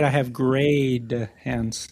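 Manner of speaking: "grayed" drawn out with a clear final D; very slow mimicry, "grayed" ending in D, not T; pointed D in grayed. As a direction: slowly quote it back, landing the D in "grayed". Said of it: "You said a D".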